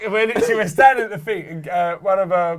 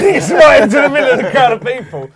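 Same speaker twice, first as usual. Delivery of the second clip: laughing shout; 7 semitones higher, 9 dB louder than usual. Retold or imitated. Retold friend's wild entrance.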